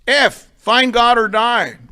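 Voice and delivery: a preacher's slow, loud ultimatum; slow emphatic declaration. Real, not acted. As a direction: lay it down word by word. Hammering home the program's maxim.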